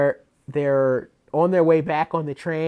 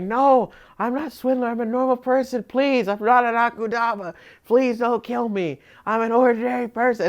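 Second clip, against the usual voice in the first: high, begging voice that slows on please don't kill me; hammed-up innocent begging for her life; fake pleading victim; 8 semitones higher than usual. Swindler begs the executioners not to kill her, high mock pleading.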